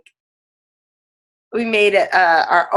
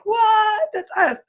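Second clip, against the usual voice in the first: much higher, delighted disbelief; surprised exclamation; slower than usual. Retold or imitated. Recognizing herself in the sketch, high surprised cry. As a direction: shoot way up in happy shock. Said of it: "that was like".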